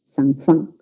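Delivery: "Zanfán" with an F where the J goes; jungle-region "Zanfán", the J sound turned into F. Shown as a demonstration. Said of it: "En lugar de decir la J con la F".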